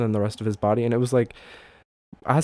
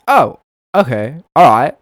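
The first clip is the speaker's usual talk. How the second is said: loud, higher mock acknowledgement; hammed-up, punchy agreement; imitated clerk's reply; 4 semitones higher, 15 dB louder than usual. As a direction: call it out big as the clerk. Acts out store clerk's reply.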